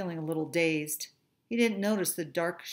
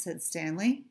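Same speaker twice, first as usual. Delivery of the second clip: plain reading voice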